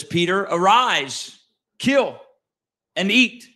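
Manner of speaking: slow commanding voice of God; voice of the Lord. Acted. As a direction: proclaim it like God calling from heaven. The Lord speaks in the vision.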